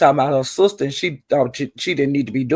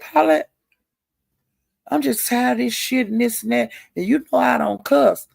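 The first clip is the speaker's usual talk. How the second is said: higher voice, fed up; imitating her friend; 8 semitones higher than usual. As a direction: whine it like a tired friend. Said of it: "and she said".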